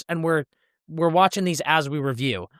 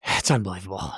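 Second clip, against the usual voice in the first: low catchphrase; 9 semitones lower, 3 dB quieter than usual. Acted. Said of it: "podcast sign-off catchphrase".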